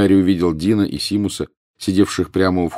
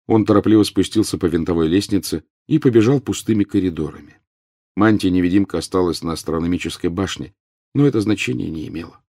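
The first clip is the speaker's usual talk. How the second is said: usual speaking voice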